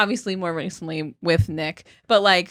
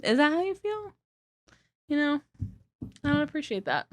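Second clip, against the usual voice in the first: mock offended; 6 semitones higher, 6 dB quieter than usual.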